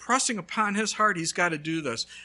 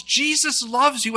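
loud, higher slow yell of Jesus loves you; 8 semitones higher, 9 dB louder, slower than usual. Retold or imitated. Young man yelling into the mailbox, full voice. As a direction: belt it at the top of your lungs. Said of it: "and he yells".

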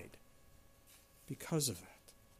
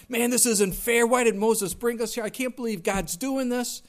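high, louder whiny grumble; mock the sulking complainers at home; griping complaint; 6 semitones higher, 5 dB louder than usual. Preacher voicing Israelites grumbling in their tents, high whiny gripe.

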